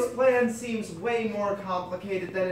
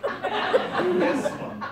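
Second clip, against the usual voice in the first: laughing; 13 semitones higher, 4 dB louder than usual. Acted. Laughing high reply in scene.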